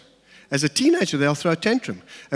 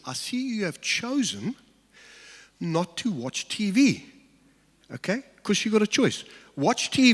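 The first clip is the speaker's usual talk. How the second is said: calm statement; 5 semitones higher than usual. Retold or imitated. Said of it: "I just said this".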